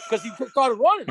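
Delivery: normal talking voice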